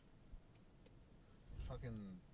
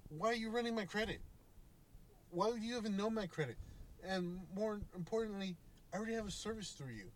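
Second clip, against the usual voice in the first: rising, exasperated questions pitched higher; retelling an annoyed argument with the phone store clerk; irritated; 7 semitones higher than usual. Retold or imitated. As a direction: push back on the clerk, getting fed up. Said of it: "I'm starting to get irritated".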